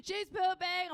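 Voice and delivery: put-on high, loud child's cheer; toddler yelling. Daughter yelling about the woman in the next stall. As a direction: shout it again like a proud kid. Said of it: "she's like".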